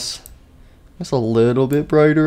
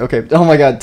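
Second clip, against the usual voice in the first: ordinary delivery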